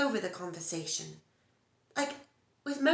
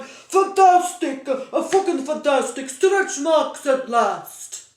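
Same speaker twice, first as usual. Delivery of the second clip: much louder and slower, hammed-up joy at the mirror; mock delighted shout; 6 semitones higher, 13 dB louder, much slower than usual. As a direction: bellow it like a man thrilled by his own belly. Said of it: "Rushing off to the mirror and going".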